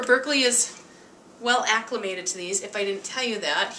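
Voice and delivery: ordinary delivery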